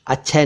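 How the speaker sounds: अक्षय said as "ach-chhay"; mispronounced अक्षय. Shown as a demonstration. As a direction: double chh in अक्षय, no k. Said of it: "अक्षय नहीं".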